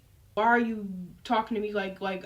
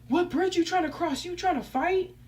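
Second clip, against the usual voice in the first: higher-pitched mocking imitation of her challenge; mimicked challenge; 7 semitones higher than usual. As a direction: mimic her picking a fight. Mimics girl's challenge from text.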